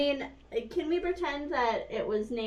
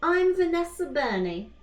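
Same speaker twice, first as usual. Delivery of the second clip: higher, put-on British accent as an English girl; a shaky try at a British accent, pitched up; attempted British accent; 6 semitones higher than usual. Mock British girl voice, introducing herself. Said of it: "I was trying to be British".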